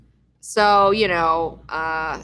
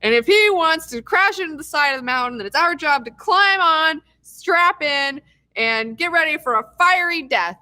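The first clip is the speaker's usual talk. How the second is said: rally the crew to certain doom, hammed up; imitating Ehrlich; 6 semitones higher than usual. Ehrlich to the team, hammed-up heroic rally. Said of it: "Ehrlich steps in and is just like".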